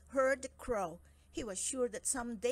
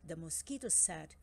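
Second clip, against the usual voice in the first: ordinary delivery, nothing marked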